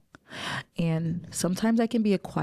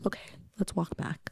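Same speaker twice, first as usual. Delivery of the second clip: gentle whisper; 9 dB quieter than usual. Retold or imitated. Friend speaks gently after crying.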